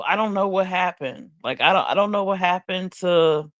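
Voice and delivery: ordinary talk, nothing marked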